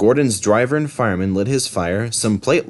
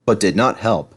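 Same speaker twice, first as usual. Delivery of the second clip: plain reading voice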